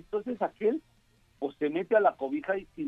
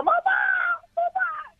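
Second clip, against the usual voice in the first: calling out mamá. Acted. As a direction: cry out to her mother in falsetto. Wife calling mother.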